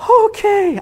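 very high, strained okay; mock panic. Crowd hears that he's coming back, mock panic. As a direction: squeak it out in mock alarm. Acted crowd reacting to bad news.

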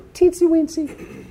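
very high, drawn-out squeak; playful tiny voice. Pretend. Speaker jokes about a tiny forgotten country, in a squeaky voice. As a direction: squeak it out slowly, like something minuscule.

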